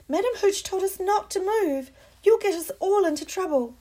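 high, loud cry; shout. Acted. Hermione shouts at Harry not to fly, high and loud. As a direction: stop him with one word.

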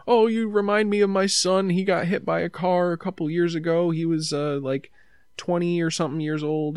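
a hint of a teary mom; gentle mock sad lady; tearful lady.

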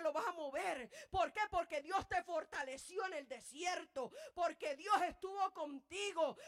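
fiery sermon climax; full-force preaching. Acted. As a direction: preach at full force, slowing on key phrases.

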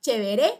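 "chévere" sin tilde, dicho "cheveré"; leído como está escrito. Retold or imitated. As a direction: termina "chévere" hacia arriba, acento al final. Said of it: "lo estoy leyendo como me escribe". ("chévere" leído mal adrede, cheveré)

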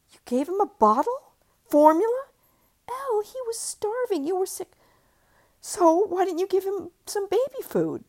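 high, loud outraged questions; angry disbelief. Acted. Mother outraged at husband for feeding formula, loud. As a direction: rise up in indignant fury.

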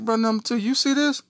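begging his brother for help; panicked plea. Retold or imitated. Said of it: "sitting there like".

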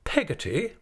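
high, incredulous rising question; affronted aunt's disbelief; indignant repeat. Acted. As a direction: echo the name with indignation, swooping up. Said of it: "repeated Miss Betsy with some indignation".

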